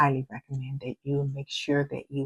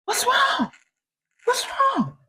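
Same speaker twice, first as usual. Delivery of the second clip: much higher put-on husband voice, anxious; worried husband; slower than usual. Husband asking his crying wife what's wrong, high and anxious. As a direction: play the startled, fretting husband. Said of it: "he goes".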